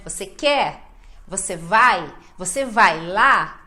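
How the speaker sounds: short questions punching "quer", "vai", "lá"; final word stressed. Shown as a demonstration. Teacher drilling short questions, strong endings on "quer", "vai", "lá". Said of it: "sempre no final".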